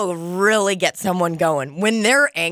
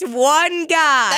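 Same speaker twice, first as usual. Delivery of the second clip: drawn-out, higher teasing jab; slow mocking tease, pitched up; 8 semitones higher, much slower than usual. Real, not acted. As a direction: poke fun slowly. Teasing friend.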